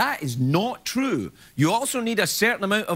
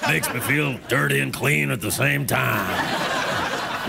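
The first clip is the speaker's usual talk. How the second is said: low, very slow character voice; hammed-up deep, unhurried delivery; put-on drawl; 5 semitones lower, much slower than usual. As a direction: drag it out, deep in the voice.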